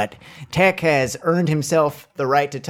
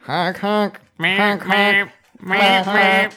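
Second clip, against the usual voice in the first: goofy horn honks, higher; silly honking; 7 semitones higher, much slower than usual. Retold or imitated. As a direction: honk like a clown horn. Imitating truck horn.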